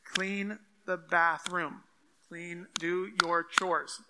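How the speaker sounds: slow, deliberate parental orders; firm, slowed-down parent voice; parent instructing kids. Acted. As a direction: spell out chores word by word. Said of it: "when I'm talking to my kids".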